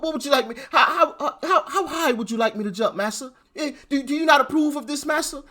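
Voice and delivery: high, pleading mock servant voice; mocking servile voice. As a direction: beg and bow, hammed up high. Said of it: "we're supposed to say".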